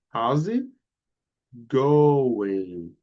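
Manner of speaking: "How's it going?" run together, the t of "it" linked into "going"; linked words. Shown as a demonstration. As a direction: join "it" and "going" with no break. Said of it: "We make a connection here".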